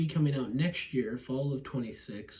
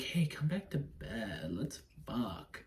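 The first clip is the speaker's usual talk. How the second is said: seductive whisper; 6 dB quieter, slower than usual. Retold or imitated. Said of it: "she's like".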